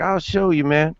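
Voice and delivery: put-on high, insistent drunk voice; drunk bum. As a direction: prove it like an offended drunk. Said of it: "And he's like".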